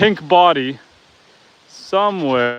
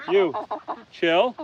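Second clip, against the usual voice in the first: normal talking voice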